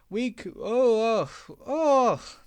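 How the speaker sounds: high loud frightened cry; trucks screaming. Acted. Trucks jerked forward by Diesel, frightened scream. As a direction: cry out in alarm. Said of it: "they screamed".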